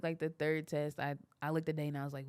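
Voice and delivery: usual speaking voice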